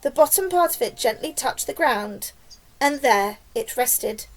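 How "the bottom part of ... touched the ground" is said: ordinary delivery, nothing marked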